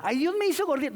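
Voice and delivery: high, loud, mock-whiny complaint; whiny excuse. Preacher mocking a congregant's excuse, high and whiny. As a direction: whine it like a lazy excuse-maker.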